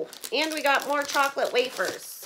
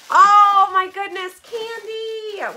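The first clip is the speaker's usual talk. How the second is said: high, loud burst of delight; excited squeal; 7 semitones higher, 8 dB louder, slower than usual. Real, not acted. Opening the candy box, thrilled and squealing. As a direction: gush over the candy.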